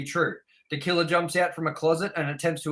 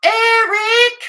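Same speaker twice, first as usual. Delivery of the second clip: high, loud mimicked scream; mock scream; 11 dB louder than usual. Host mimicking an annoying actress in Jurassic Park, mock scream. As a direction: shriek the name in exaggerated imitation. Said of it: "the amount of times she screams".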